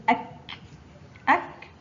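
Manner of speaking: أك stopped firmly on k; letter with sukun. Shown as a demonstration. Teacher shows kaf with sukun, أك stopped on k. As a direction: say أك as one isolated closed syllable. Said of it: "حرف الكاف فوقه سكون ينطق".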